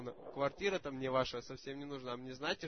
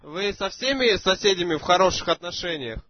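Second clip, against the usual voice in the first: louder for a bad line; speaking up loudly; 2 semitones higher, 14 dB louder than usual. Real, not acted. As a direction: raise the voice so she hears. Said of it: "говори, чтоб тебя слышно было".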